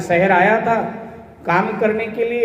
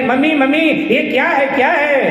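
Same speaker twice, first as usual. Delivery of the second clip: higher-pitched startled calling; sudden excited cry; 4 semitones higher than usual. Acted. Bride seeing butter first time calls mother-in-law, crying out. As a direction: cry out for mummy all at once. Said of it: "तो एकदम से चिल्लाई".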